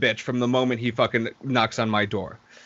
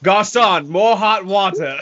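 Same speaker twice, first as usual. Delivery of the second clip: mock posh diner; 4 semitones higher, 5 dB louder, slower than usual. Mock posh bath order.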